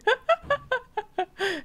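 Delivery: high-pitched burst of real laughter; loud high giggling. Real, not acted. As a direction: let a high laugh break out.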